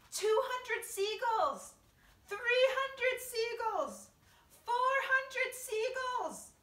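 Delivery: high, stretched triumphant shout. A narrator's reading. James announcing the tally, shouting. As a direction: holler the count slowly and loudly. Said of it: "he shouted".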